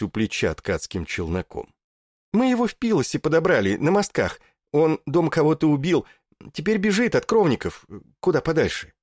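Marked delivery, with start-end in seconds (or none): 2.3-8.9 quick eager chatter, high; rattling off. A narrator's reading. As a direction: rush it out breathlessly. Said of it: "затараторил Пирей". (тараторит взахлёб)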